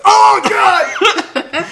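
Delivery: high, loud burst of laughter; laughing shout. Real, not acted. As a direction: yelp it out mid-laugh. Shocked laugh at the joke.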